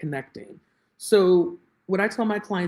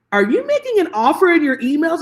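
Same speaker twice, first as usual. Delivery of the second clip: exasperated question; 9 semitones higher, 6 dB louder than usual. Retold or imitated. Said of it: "and I was like".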